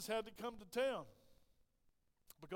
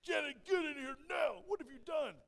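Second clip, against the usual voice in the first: high, loud boss's bellow; angry yelling; 8 semitones higher, 6 dB louder, slower than usual. Retold or imitated. Boss summons Clint over the email, angry yelling. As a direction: yell down the hall, dragging out the order. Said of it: "You hear this yelling".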